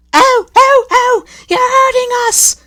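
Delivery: very high, loud wailing coaches; crying out in pain. A narrator's reading. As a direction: moan as if hurt. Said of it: "the coaches cried".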